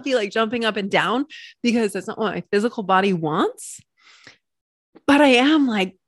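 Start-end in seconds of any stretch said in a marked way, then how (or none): none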